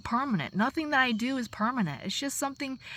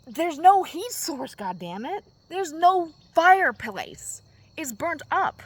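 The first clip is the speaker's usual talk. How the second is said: louder, slow, exasperated; slow, loud, fed-up retelling; retold exasperation; 5 semitones higher, 7 dB louder, slower than usual. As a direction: spell it out in frustration, loud and slow. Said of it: "I was like".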